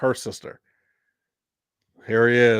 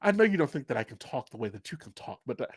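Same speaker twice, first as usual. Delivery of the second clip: soft, quick British-style imitation; mock British accent, half-whispered; put-on proper accent; 7 dB quieter, faster than usual. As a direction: imitate Mom's proper British talk, hushed and rapid. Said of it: "start talking in an accent".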